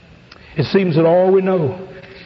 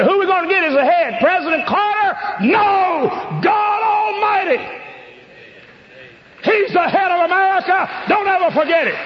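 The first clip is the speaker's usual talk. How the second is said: full-force preacher's shout, much higher; preaching shout. Real, not acted. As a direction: cry it out to the whole congregation. Preacher builds to thundering climax.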